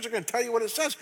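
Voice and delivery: ordinary talk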